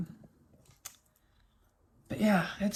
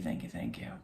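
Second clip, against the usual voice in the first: heartfelt thanks; 9 dB quieter than usual. Real, not acted.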